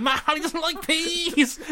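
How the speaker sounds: high, drawn-out imitation of an excited friend; mock excitement. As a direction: blurt it high and slow, laughing, as hammed-up hype. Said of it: "James went".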